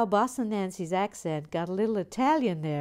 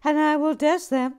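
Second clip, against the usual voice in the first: loud comic reading; 6 semitones higher, 9 dB louder than usual. Acted. Host reads a cheeky viewer comment to Jackie aloud, hammed-up. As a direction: read it out loud and higher, hamming it up.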